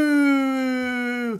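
high held woo; gleeful whoop. Real, not acted. Gamer riding a bike across the farm, high gleeful whoop. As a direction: cheer as the bike takes off.

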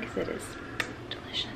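very soft, almost breathless; whispering. Real, not acted. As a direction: hush it down to nearly nothing. Voice drops to near whisper.

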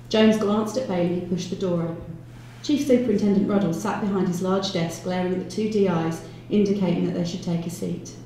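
ordinary delivery, nothing marked

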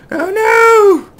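very high, loud wail of alarm; shrieked dismay; alarmed cry. Real, not acted. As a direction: cry out as the plane careens away. Plane careening out of control.

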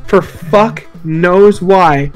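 ordinary talk

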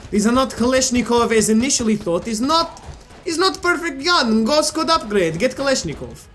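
joking, hammed-up Russian accent; bad Russian accent. Gamer rates the Thompsons against Kalashnikov, bad Russian accent. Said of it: "I should really stop doing this accent".

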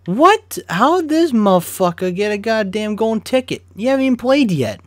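high, loud, incredulous rant; outraged shout. Real, not acted. Gamer outraged at a golden ticket card, shouting. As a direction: yell it in real disbelief.

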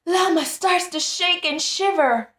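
high, loud wailing sob; mock crying. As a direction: wail it like a little one crying for his toy. Llama crying for his Fuzzy.

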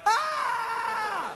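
a long high falsetto scream; high wail. Retold or imitated. Answering his wife's accusation with a long high scream. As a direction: shriek like a scared kid.

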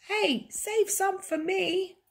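very high, slow put-on voice; squeaky little character. A narrator's reading. Character asking for some eggs, squeaky.

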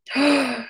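sharp delighted gasp; excited gasp. Real, not acted. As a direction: gasp in happy surprise.

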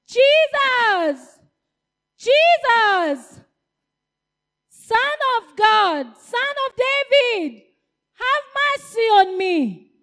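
high, loud desperate calling; shouting. Acted. Blind man shouting for Jesus, high and loud. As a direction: cry out to be heard over the crowd. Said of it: "He kept calling".

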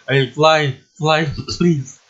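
high, loud mock-panicked plea; freaking out. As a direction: plead in a laughing panic. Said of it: "I'm just freaking out".

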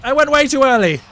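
high, loud cry of dismay; alarmed shout. Real, not acted. Player to teammate after jumping early, alarmed shout. Missed the ball in play.

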